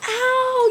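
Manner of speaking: high mock cry of pain; pained yelp. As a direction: yelp as a sore spot is pressed. Acted pain when butt touched.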